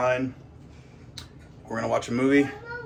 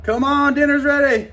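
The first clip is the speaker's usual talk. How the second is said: yelling across the room; 11 semitones higher, 4 dB louder than usual. Real, not acted. Calling child to dinner.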